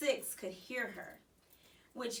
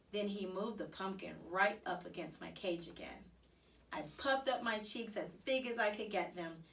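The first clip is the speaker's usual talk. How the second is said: ordinary delivery, nothing marked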